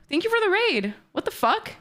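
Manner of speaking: usual speaking voice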